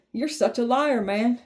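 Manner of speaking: normal talking voice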